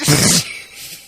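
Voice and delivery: giggle. Real, not acted. Laughing at the drunk impression.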